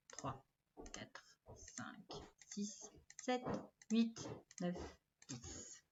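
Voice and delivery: hushed counting, half voiced; whispered counting. Shown as a demonstration. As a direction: count softly under your breath. Counting along quietly with the class.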